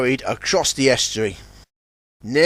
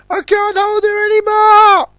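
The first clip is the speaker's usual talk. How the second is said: very high strained cry; 6 dB louder than usual. A narrator's reading. Ten Cents crying out straining against the liner. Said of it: "cried Ten Cents".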